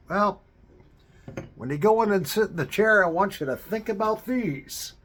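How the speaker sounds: high, loud, quick friend's voice; excited friend. Retold or imitated. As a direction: voice her eager pitch, pushing fast. Friend's bold offer voiced.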